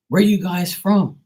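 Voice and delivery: high, loud, slow question; mock bafflement. Bostonians puzzled by the visitors' talk, mock bafflement. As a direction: ask it in exaggerated bewilderment.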